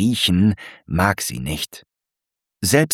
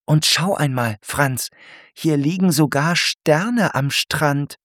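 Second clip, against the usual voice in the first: Alberta amazed; 7 semitones higher than usual. Acted. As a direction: marvel at stars on the sand. Alberta marvels at starfish.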